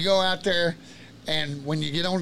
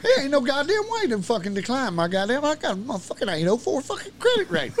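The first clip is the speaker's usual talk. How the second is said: retold angry rant; 9 semitones higher than usual. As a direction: vent all over again at being declined. Retold blow-up at the salesman.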